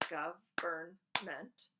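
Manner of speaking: drawn out slowly, each syllable clapped apart. Shown as a demonstration. Teacher slowly sounding out Government for counting syllables. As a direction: stretch it out so kids can count the beats in Government. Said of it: "I have taught you how to count syllables by clapping them".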